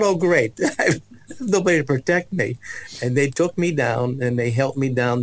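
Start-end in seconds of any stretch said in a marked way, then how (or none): 0.0-2.6 high, wry oh great with a laugh; sarcastic despair of a boy; ironic dismay. Retold or imitated. A boy led down the aisle, wryly dismayed with a laugh. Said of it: "I thought".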